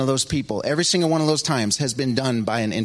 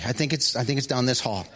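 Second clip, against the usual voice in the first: ordinary delivery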